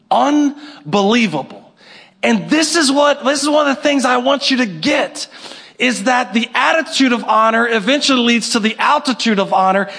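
ordinary delivery

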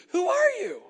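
high-pitched, mock-startled cry; startled question. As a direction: shoot up high in alarm at an unseen speaker. Blinded Saul answers the voice.

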